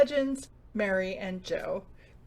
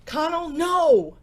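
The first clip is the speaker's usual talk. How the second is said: loud, slow "Connell, no!"; stretched-out alarmed yell; shouted order; 7 semitones higher, 8 dB louder, slower than usual. Acted. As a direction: shout him down before he opens it. Boss stops him opening door.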